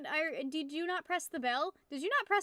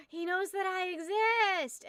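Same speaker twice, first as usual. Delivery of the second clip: higher, louder and slow; thrilled; 7 semitones higher, 4 dB louder, slower than usual. Retold or imitated. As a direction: gush like a starstruck fan. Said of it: "and I was like".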